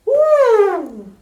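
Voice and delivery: very high, very loud whooping shriek; full-blast startled old-woman whoop; Grandma's yell. A narrator's reading. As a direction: shoot up into a piercing "woo". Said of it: "Grandma yelled".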